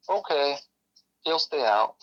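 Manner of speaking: put-on flat robotic child voice; robot-like, emotionless reply. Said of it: "talking to me like a robot".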